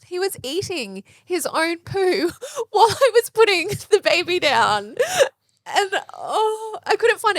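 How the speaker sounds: laughing through tears at the memory; tearful laughing. Real, not acted. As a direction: mum near tears retelling the poo-eating. Mum nearly crying retelling it.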